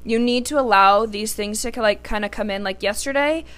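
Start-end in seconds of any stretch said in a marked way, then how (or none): none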